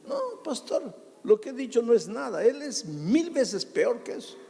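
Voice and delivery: high-pitched imitation of a bitter wife; imitated complaining wife. Pastor voicing the wife who insists her husband is worse, high and pleading. Woman in story answers pastor.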